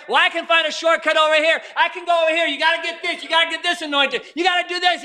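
a hurried, high rabbit voice, preached loud; hasty rabbit. Retold or imitated. Rabbit scrambling for a shortcut, loud and high. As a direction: chatter like a rushing rabbit. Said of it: "The rabbit's running around".

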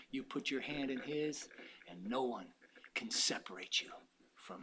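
very soft, low, soothing preaching; quiet reassurance. Real, not acted. Preacher tells believers they are secure, hushed reassurance. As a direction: drop low and gentle to reassure them.

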